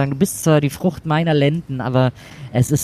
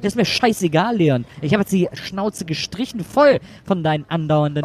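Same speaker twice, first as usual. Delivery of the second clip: much higher, furious scolding; angry yell; 6 semitones higher than usual. Acted. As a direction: let him have it. Mother yelling at son. (wütend schimpfend)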